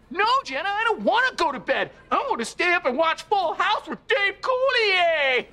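high-pitched bedtime tantrum; whining little kid. Acted. Reminding his old babysitter who he is, kid's whine. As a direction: protest like a stubborn child, high and whiny. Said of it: "maybe this will remind you".

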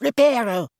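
high, firm spell-casting call. A narrator's reading. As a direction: cast the spell with firm command. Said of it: "said firmly".

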